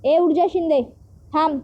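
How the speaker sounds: ordinary delivery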